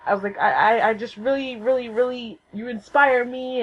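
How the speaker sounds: high, tumbling fangirl confession; acted-out starstruck gush. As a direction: blurt it out, tripping over your words. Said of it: "I'm gushing at this point".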